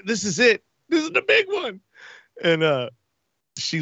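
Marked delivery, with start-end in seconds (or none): 0.1-1.8 high, half-crying cry that this is the end; mock terror. In the hospital, sure he's dying, a high half-sob. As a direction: wail that this is the big one.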